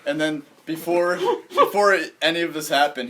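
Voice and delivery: usual speaking voice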